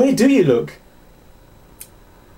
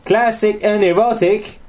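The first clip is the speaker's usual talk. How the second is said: slow, higher-pitched bad Southern US accent; hammed-up Southerner, words drawn out; mock Southern accent; 4 semitones higher, slower than usual. Host reading a game review aloud, mock Southern accent. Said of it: "This is my Jerry Springer Southerner".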